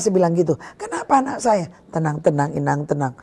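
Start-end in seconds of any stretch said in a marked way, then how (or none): 0.7-1.7 higher, softer frightened plea; a mother dreading the answer; frightened question. Retold or imitated. Mother begs the pastor about her child, frightened and higher. 1.9-3.1 lower, softer soothing words; calming voice. Retold or imitated. Pastor soothes the frantic mother, lower and softer.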